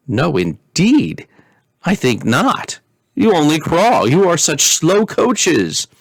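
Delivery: high, slow, mocking little princess; teasing princess. Princess teasing her parents.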